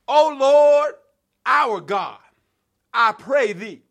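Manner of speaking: lifted call to the Lord; prayerful cry. Acted. Preacher leading the church in prayer, calling out. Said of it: "somebody ought to say that with me".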